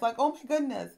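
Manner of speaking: ordinary delivery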